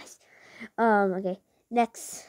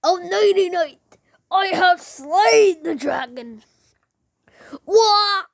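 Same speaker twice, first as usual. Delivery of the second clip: loud, hammed-up anime battle cries, higher; anime hero voice; 7 semitones higher, 6 dB louder, much slower than usual. YouTuber hams up an anime hero, loud battle cries. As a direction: shout like an overblown anime swordsman. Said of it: "my first anime voices".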